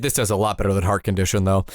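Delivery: usual speaking voice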